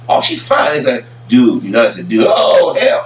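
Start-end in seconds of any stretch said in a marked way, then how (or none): 0.1-0.8 high appreciative she fine; a mock admiring oh she fine; ogling. Ogling woman on TV. 2.3-3.0 loud unvoiced oh hell; a loud whispered shout of disgust. Real, not acted. Disgust at realizing it's a guy.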